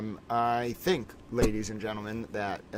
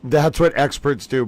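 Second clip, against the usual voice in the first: mock witness; 2 semitones higher, 10 dB louder than usual. Echoing the witness clip.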